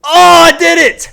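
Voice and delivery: loud, high, slow victory yell; triumphant cheer. Real, not acted. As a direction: shout it out like you just won. Winning a game, cheering.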